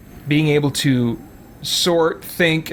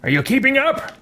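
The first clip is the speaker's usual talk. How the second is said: high, rising character call; game character voice; 11 semitones higher than usual. Pretend. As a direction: put on a pitched-up hero voice, taunting lightly. Voicing his game character.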